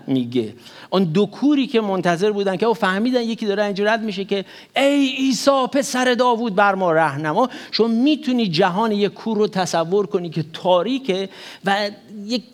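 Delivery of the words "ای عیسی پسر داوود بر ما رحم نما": blind men crying out. Retold or imitated. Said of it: "به یونانی فریاد زد".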